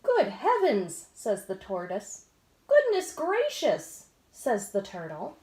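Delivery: lively storybook read-aloud with voices; animated picture-book narration; reading to children. A narrator's reading. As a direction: read it like bedtime story time.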